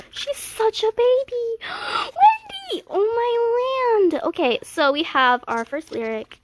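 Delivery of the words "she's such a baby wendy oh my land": very high, loud cry of delight; excited shriek. Real, not acted. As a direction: shriek her name in delight. Fan screaming at idol photos.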